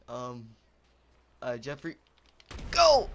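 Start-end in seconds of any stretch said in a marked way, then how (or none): 2.7-3.1 high, very loud shout; sudden yell. Real, not acted. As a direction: bellow it out in one burst. Sudden yell at Jeffrey in game.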